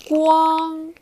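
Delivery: 光 held high and straight; 光 as a high level first tone. Shown as a demonstration. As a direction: sing 光 on one high flat pitch. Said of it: "the first tone is high and straight".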